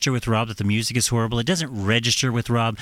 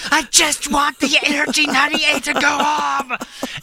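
much higher, louder retold scream; screaming at Rob over the radio; 4 dB louder, slower than usual. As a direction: yell it out, fed up and losing it. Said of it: "And I'm, like, screaming".

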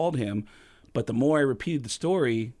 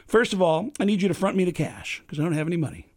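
higher, fast-building Kinison rant; Sam Kinison impression; 6 semitones higher, 3 dB louder than usual. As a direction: pitch the scheme like Kinison. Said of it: "And I said".

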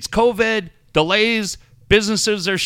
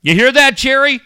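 loud, higher-pitched challenge; raised-voice taunt at someone absent; calling someone out; 6 semitones higher, 7 dB louder than usual. Real, not acted. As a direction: call out the store owner loudly. Calling out absent Jerry on air.

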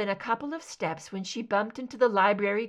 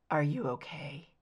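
lower, softer, gentle check-in; soft concerned question; 6 semitones lower, 4 dB quieter than usual. Acted. Mulder crouches before injured Scully, asking softly and with concern. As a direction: ask gently, worried.

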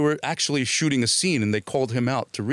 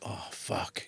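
muttered under the breath; inner curse; 4 semitones lower, 13 dB quieter than usual. Retold or imitated. As he realizes his mistake, a quiet curse. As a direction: swear quietly to yourself. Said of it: "In my mind".

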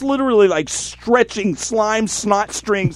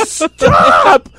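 desperate shouting; 11 semitones higher, much slower than usual. Retold or imitated. Teller shouts at his partners to take him to the ER. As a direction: yell at them to stop. Begging to go to hospital.

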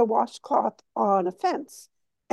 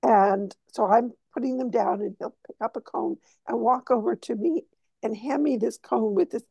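usual speaking voice

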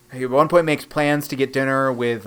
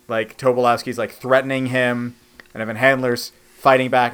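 ordinary delivery

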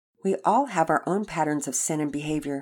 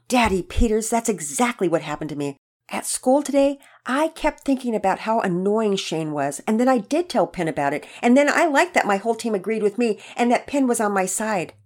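higher, louder rush of realization; eager confession; 4 semitones higher, 4 dB louder than usual. Acted. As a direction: pour out the confession with raised energy. Boy blurts out confession to father.

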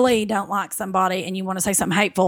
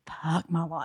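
low, soft husband voice; imitated hushed, low grumble; muttered dismay; 4 semitones lower, 10 dB quieter, slower than usual. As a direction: mutter it under your breath, defeated. Husband's dismayed reaction, acted.

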